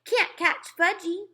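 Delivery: put-on high, slow, loud teasing toddler holler; a little boy yelling as he runs off. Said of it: "he hollered".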